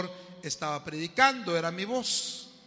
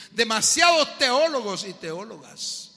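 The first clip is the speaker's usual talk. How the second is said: loud, slow, high preacher's cry; a pastor thundering from the pulpit; fiery preaching; 4 semitones higher, 9 dB louder, slower than usual. Acted. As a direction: rebuke the congregation at full force. Preaching at full force.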